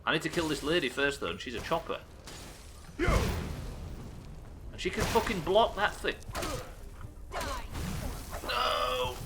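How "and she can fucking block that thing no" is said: dismay. Real, not acted. Gets blocked and killed in game.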